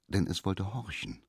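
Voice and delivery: ordinary delivery, nothing marked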